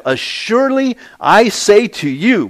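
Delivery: slow, high, loud declaration; drawn-out, ringing pulpit emphasis; emphatic repetition. Acted. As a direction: proclaim each word with full weight. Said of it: "he says it twice".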